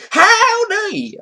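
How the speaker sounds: high, loud southern holler; southern accent. Acted. Reading out a chat greeting, loud southern accent. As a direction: belt it out with a southern twang. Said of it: "said that in a southern accent".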